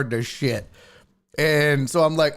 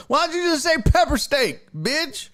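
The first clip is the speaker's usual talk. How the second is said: high indignant rant; mock outrage; 10 semitones higher than usual. Ranting at chat about the fancy steak name, indignant. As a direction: scold the fancy menu, much higher.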